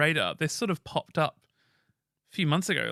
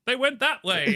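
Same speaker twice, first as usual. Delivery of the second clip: high, hammed-up bit-part line; mock dramatic minor character, higher voice; bit-part player; 9 semitones higher, 4 dB louder than usual. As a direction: overplay a tiny walk-on role, pitched up. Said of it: "play the person who goes".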